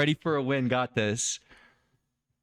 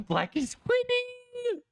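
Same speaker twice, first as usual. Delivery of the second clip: very high, slow squeal of amazement; stretched high-pitched shriek of excitement; squealing disbelief; slower than usual. Real, not acted. Stunned at the chess position.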